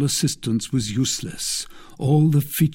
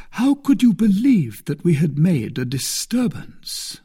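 higher-pitched, lightly wounded reproach; reproachful; 8 semitones higher, 3 dB louder than usual. A narrator's reading. Aramis to a friend who doubted the musketeers, reproachful. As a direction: reproach a friend with a raised, questioning voice. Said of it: "said Aramis in a reproachful tone".

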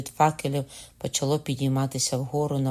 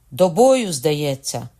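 eager battle call; 8 dB louder, much slower than usual. A narrator's reading. Drawing pistols for a fight.

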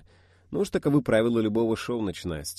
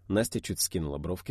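ordinary delivery

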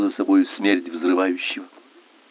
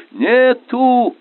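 high, urgent battle shout; yelled report from a miner; shouted cry; 13 semitones higher, 4 dB louder than usual. A narrator's reading. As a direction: cry it out high across the deck. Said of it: "крикнул он".

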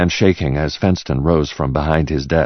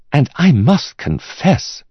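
slow, higher appraiser's voice; confiding art expert; 10 semitones higher, slower than usual. Acted. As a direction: draw out the admission as an expert. Expert continues his verdict.

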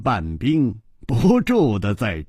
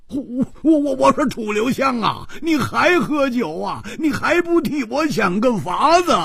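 high, stammering, exasperated yell; loud roaring; 10 semitones higher than usual. Acted. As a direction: roar at a friend who won't help. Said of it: "大声地吼着说". (急得大声吼着说)

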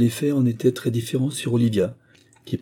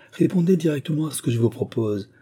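higher-pitched, pressing demand; exclaimed demand; 6 semitones higher than usual. A narrator's reading. Squire corners Moïse in debate, exclaiming. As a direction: cry out, pushing for an answer. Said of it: "s'écria le squire".

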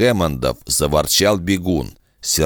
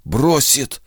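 hoarse, higher outburst; 8 semitones higher than usual. A narrator's reading. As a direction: rasp the word out. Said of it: "хрипло закончил он".

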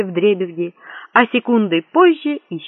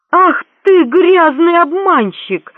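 high, loud, drawn-out furious cry; explosive outburst; 11 semitones higher, 7 dB louder, slower than usual. A narrator's reading. Eavesdropper outraged at the prince's trick, exploding. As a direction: explode with outrage, stretching the words. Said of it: "взорвался Лафайет".

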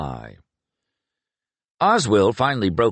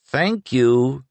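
exasperated reply; 5 semitones higher, 3 dB louder than usual. A narrator's reading. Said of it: "replied to himself".

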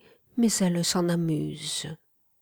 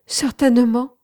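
high, loud moan of assent; 6 semitones higher, 6 dB louder than usual. A narrator's reading. The painter agrees to be tied up, moaning it. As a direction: let the answer out as a raised, mild moan. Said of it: "gémit-il".

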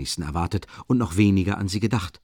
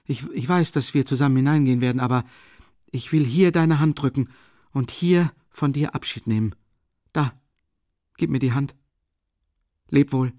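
raised, urgent farewell; Raskolnikov farewell; 6 semitones higher, 3 dB louder than usual. Acted. Raskolnikov bidding his friend farewell, raised and urgent. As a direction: press the handshake on him.

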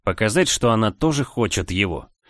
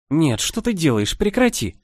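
higher, squealing protest; being tickled; 6 semitones higher than usual. Acted. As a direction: protest through ticklish giggles. Kiss on neck tickles her.

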